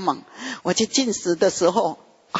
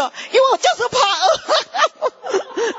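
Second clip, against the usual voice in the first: fearful cry; 14 semitones higher, slower than usual. Retold or imitated. Telling of her fear of evil.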